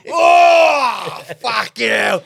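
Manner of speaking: acted toilet-stall holler; mock shout. Acting out a public toilet reaction.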